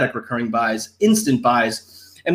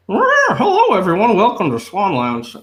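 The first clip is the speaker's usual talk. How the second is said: high squeaky puppet voice; ventriloquist puppet voice; 6 semitones higher, 3 dB louder than usual. Pretend. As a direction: voice the toy swan like a dummy. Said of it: "Nobody likes ventriloquism".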